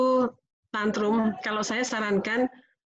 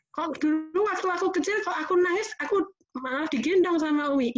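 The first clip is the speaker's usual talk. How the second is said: put-on child voice; 8 semitones higher than usual. Child asking mother.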